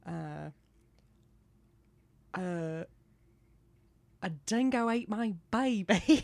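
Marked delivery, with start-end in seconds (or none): 4.2-6.2 put-on "A dingo ate my baby" said in a slightly raised Australian accent. Shown as a demonstration. Showing the Australian warm-up line in an Australian accent. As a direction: hit "dingo" in a light Aussie accent. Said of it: "I have to say".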